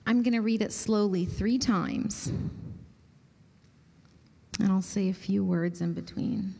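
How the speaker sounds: soft, unhurried voice easing them into stillness; guided meditation. Acted. Pastor guiding the group into quiet prayer, soft meditation voice. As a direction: let each phrase settle before the next. Guides listeners to empty thoughts.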